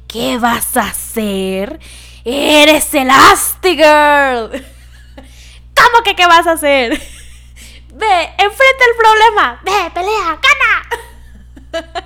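loud, high, brisk designer diva; Edna Moda. Retold or imitated. Edna Moda scolding a defeated hero, loud and high. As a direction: snap her out of it like a fierce fashion mentor. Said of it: "Edna Moda es lindísima".

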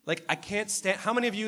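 mock playground disgust, shrill and loud; grossed-out God. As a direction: squeal it like a kid dodging cooties. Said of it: "And God's like".

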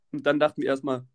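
plain reading voice

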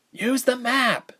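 high, drawn-out exasperated shriek. A narrator's reading. Ilse shrieking at Reshma while running, high exasperated shriek. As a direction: throw it up high in frustration. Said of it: "Ilse shrieked".

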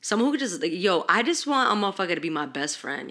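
normal talking voice

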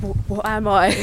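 tentative go at a Scouse accent; put-on Scouse accent. Shown as a demonstration. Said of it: "speak in a Scouse accent".

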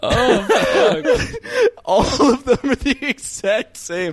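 high, slow words through real laughter; laughing. Real, not acted. Laughing at a list where every pickup line is the same, speaking high through laughter. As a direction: barely get the words out for laughing. Reading absurd repeated pickup lines.

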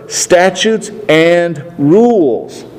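very slow, heavy "statutes and rules"; slow, ominous reading of "statutes and rules"; dreaded words, drawn out. Shown as a demonstration. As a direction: drag out each word like a threat. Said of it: "He says those deadly words".

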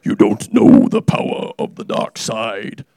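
low, slow menacing Vader voice; Darth Vader. Pretend. Preacher showing off for the congregation, a slow, low Darth Vader. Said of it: "I see I can do Darth Vader now".

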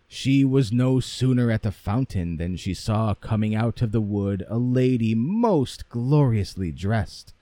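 ordinary delivery, nothing marked

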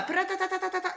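high rapid-fire nonsense syllables imitating German; mock German chatter. Nervous mother imitates the doctor talking, high mock German chatter. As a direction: rattle off "ta-ta-ta" like incomprehensible German. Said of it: "ela ainda tava falando alemão".

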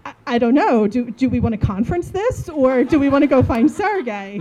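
can barely get it out for laughing. Real, not acted. Recalling the embarrassing mix-up, laughing loudly.

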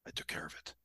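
near-whispered, drained mock report; barely voiced imitation of someone worn out after a fight; hushed retold reply. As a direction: act out a battered man's very quiet answer. Retold janitor emerging battered from fight.

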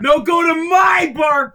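loud high yelling; shouting. Real, not acted. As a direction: yell it over the others. Friends competing about barbers.

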